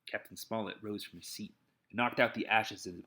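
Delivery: plain reading voice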